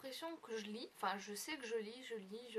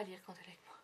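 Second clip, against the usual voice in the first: fast exasperated whisper; 4 semitones lower, 8 dB quieter, much faster than usual. Real, not acted. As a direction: hiss it quickly under your breath. Same complaint whispered fast aside.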